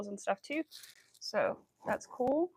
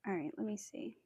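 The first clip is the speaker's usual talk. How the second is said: soft unvoiced muttering to herself; whispered aside; 10 dB quieter than usual. Real, not acted. Looking over her work, whispering to herself. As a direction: whisper while concentrating.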